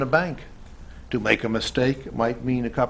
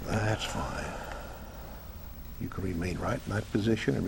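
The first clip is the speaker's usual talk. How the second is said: soft, quick soothing murmur; hypnotic deepening; 4 semitones lower, 6 dB quieter than usual. Acted. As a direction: ease her deeper. Deepening the trance.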